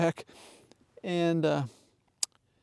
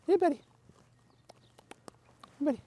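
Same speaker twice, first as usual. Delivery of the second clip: talking to a dog; 8 semitones higher, 6 dB quieter, much faster than usual. Real, not acted. Greeting dogs, high pet voice.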